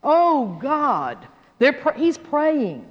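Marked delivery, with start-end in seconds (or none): 0.0-1.3 crying out. Acted. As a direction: cry out in acted desperation. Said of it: "hear him crying out".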